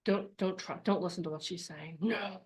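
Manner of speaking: the fear monster pleading quietly, frightened; fearful whisper-like plea. Retold or imitated. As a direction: beg them not to listen, hushed. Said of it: "Then we have the fear".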